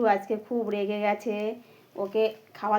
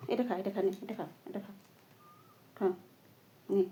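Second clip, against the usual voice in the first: soft coaxing; 5 semitones lower, 13 dB quieter than usual. Real, not acted. Softly feeding the parrot.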